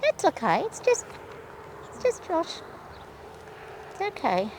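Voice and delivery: soft, hushed reassurance; calm her down gently; soothing. Real, not acted. Rider soothes her spooked horse, soft and hushed.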